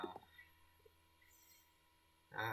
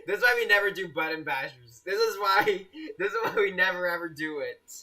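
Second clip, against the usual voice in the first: loud, raised-pitch exasperation, breaking into a laugh; exasperated outburst; 4 semitones higher, 7 dB louder than usual. Real, not acted. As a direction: complain loudly to the chat, half laughing. Mock-exasperated rant at chat's button bashers.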